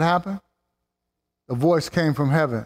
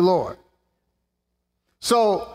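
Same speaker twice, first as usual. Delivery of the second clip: ordinary talk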